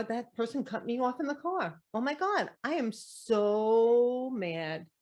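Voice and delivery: playfully dramatic fit of anger; mock outrage. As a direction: act out being fed up after getting cut off. Acting out road rage complaint.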